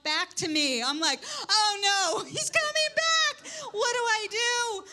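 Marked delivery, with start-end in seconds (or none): 1.5-4.8 high, loud mock panic; panicked and playing it up for laughs. Grandmother panics as the boy walks back, hammed-up alarm.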